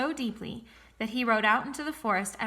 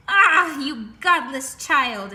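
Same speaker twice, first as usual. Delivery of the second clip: very loud, slow, higher cry; furious witch outburst; 4 semitones higher, 11 dB louder, much slower than usual. A narrator's reading. Sorceress furious at Rapunzel's slip, crying out loudly. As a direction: spit it out in outrage. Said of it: "exclaimed the sorceress".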